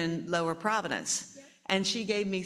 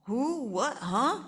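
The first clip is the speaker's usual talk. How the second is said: startled; 6 semitones higher, slower than usual. Acted. Mother startled again.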